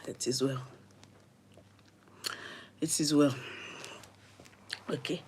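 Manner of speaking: low, very soft, settling herself; calming down. Real, not acted. Storyteller composing herself before going on, calming down. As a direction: murmur it to steady yourself. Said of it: "I have to calm down".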